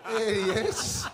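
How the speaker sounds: loud laugh through the words, pitched high; real amused outburst. Real, not acted. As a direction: crack up as you point him out. Spots the heckler, laughing.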